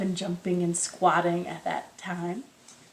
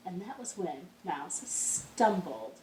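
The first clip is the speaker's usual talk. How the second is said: softer, slow bedtime narration; hushed storytelling; 7 dB quieter than usual. A narrator's reading. Teacher reading a folk tale to the children, hushed.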